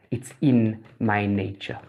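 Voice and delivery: low, slow, soft delivery; scorpion's matter-of-fact reply. Acted. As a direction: let the line land quietly and slowly. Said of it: "The scorpion replies".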